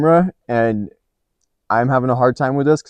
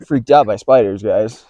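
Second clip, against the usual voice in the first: usual speaking voice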